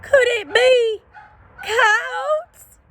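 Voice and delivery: high, small timid dog voice; a high, timid sidekick pipe; Drover's voice. A narrator's reading. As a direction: squeak it out like a nervous little dog. Drover asks nervously.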